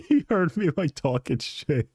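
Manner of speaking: high-pitched through real laughter; laughing. Real, not acted. Streamer amused at dad overhearing him, laughing high. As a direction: squeeze the words out while laughing.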